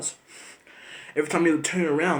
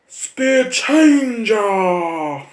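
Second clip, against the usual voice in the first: a long, drawn-out, very slow louder plea; mock beggar call; 5 semitones higher, 8 dB louder, much slower than usual. Imitating roadside beggars asking for change, slow drawn-out call. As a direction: stretch it out like a beggar calling from the roadside.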